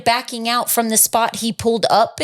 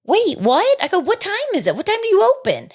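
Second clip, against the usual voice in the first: startled confusion; 10 semitones higher than usual. Retold or imitated. Realizing the store is closed.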